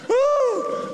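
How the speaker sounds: whoop. Real, not acted. Preacher whooping at own joke.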